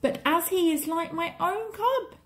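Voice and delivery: high-pitched pleading wolf voice; protesting father wolf. A narrator's reading. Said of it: "protested Rama".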